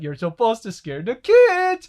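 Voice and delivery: mock protest, loud and slow; hammed-up loud complaint; Tom. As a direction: wail it out, stretching the last word. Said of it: "And Tom's like".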